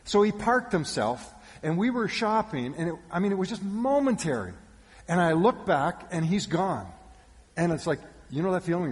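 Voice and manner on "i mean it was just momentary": ordinary talk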